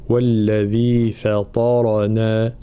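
slow tajweed recitation of "والذي فطرنا" with a light فاء and a heavy, full طاء and راء; Quranic recitation. Shown as a demonstration. Teacher modelling the verse for students, slow and precise. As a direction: recite "فطرنا" slowly, thin fa then heavy ta. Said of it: "لابد من ترقيق الفاء وتفخيم الطاء".

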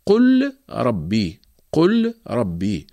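قل ربي slowly with the L merged into a doubled R; slow recitation. Shown as a demonstration. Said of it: "مثال حرف الراء قول الله تعالى".

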